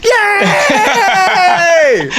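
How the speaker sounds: very high, long imitation cheer; hammed-up, sustained "yay"; whole-row cheer. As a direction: cheer like a row of delighted friends. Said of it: "the whole row went".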